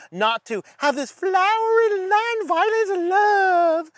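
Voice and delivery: high, drawn-out scornful mock of non-violent love; mocking sarcasm. Preacher mocking a book's pacifist Elisha, sarcastic.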